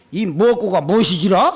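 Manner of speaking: lightly imitated Jeolla dialect. Young Jeolla man questioning the monk, lightly imitated Jeolla dialect. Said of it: "순수한 전라도 말로".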